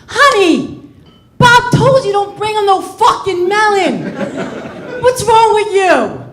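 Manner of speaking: high, loud nagging scold; scolding wife. Retold or imitated. Wife scolding husband for serving melon, high and loud. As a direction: scold him like a shrill wife. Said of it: "I'm like".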